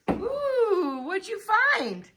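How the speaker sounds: high, hushed pretend wonder; mock surprise. Teacher asking the child what he found, high playful wonder. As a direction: coo in playful curiosity.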